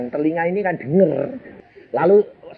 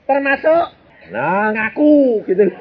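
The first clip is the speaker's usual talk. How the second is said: ordinary delivery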